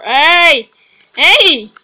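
loud hey; real playful yell; playful shout. Real, not acted. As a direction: shout it during the tug. Tug of war shout.

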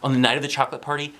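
ordinary delivery

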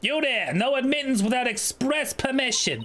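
acted stern gatekeeper; officious guard. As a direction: challenge intruders officiously. Guard challenges visitors.

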